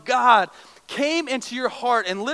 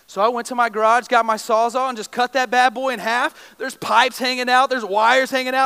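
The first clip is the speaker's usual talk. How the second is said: normal talking voice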